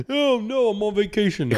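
high, careless coworker voice; squeaky clueless coworker; mock coworker voice. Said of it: "To get someone killed on accident".

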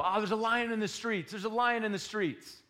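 high whiny excuse voice; high mock whine about a lion; lazy man whining. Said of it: "The lazy man will go".

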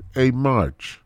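ordinary talk

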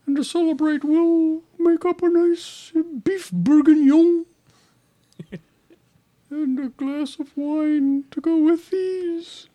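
high, slow, warbling TV chef; hammed-up Julia Child impression. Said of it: "That's my Julia Child".

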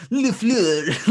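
LaFleur said loo-FLUH in a hammed-up French accent; mock French accent. Podcaster imitating Stephen A. Smith on First Take, mock French accent. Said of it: "he does it in the French accent".

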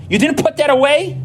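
high-pitched scolding parent voice; nagging parent. Retold or imitated. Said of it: "And it's like".

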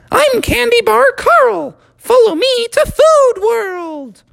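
very high, squeaky cartoon voice; a tiny sugary cartoon character; Candy Bar Carl. Candy Bar Carl inviting Froggy along, high and squeaky.

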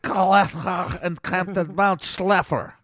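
mock Yiddish accent, slow; hammed-up Yiddish lilt, drawn out.

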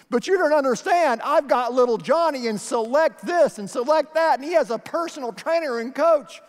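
high, loud pushy-parent mimicry; protesting parent. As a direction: put on an indignant parent bragging about their kid.

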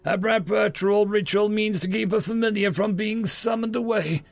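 high, gloating villain's explanation; haughty old magistrate crowing; boastful. A narrator's reading. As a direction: boast over a trapped foe. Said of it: "the old magistrate boasted".

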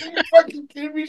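high, stunned disbelief; can't believe what sister just said; incredulous. Retold or imitated. Reacting to sister's confession, high stunned disbelief. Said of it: "I'm like".

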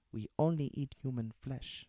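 high, loud creature voice with a put-on African accent; high-pitched story creature, African accent; childlike forest creature. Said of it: "a gentle voice that sounded as if it were a child".

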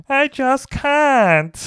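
very high, slow, drawn-out imitation; hammed-up squawk, stretching every word; mock film actress. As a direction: copy a screechy diva at a diction lesson. Joins in mimicking film actress.

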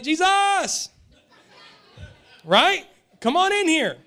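high, loud call to her son. Retold or imitated. Mother calling her son inside, loud. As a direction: call him in from the other room, mock mom.

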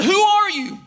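high, projected call into the distance. Retold or imitated. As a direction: call out to an unseen stranger. Boy calls out from the cliff.

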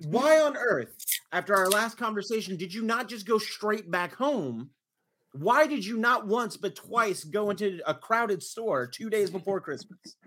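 high-pitched indignant interrogation; livid. Retold or imitated. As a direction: retell a scolding, outraged and emphatic. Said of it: "because I was livid".

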